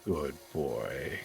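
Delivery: put-on low, soft good boy; soft pet praise. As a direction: coo it gently to a pet.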